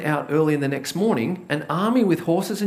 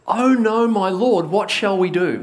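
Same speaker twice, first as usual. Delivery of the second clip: louder, rising cry of panic; panicked servant; 3 semitones higher, 5 dB louder than usual. Retold or imitated. Panicked servant crying out to Elisha. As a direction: servant panicking as the army surrounds them. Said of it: "he turns to Elisha in panic".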